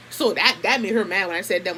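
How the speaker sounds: ordinary talk, nothing marked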